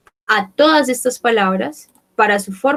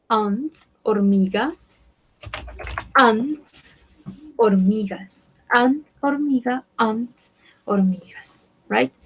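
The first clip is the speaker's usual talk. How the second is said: ordinary talk, nothing marked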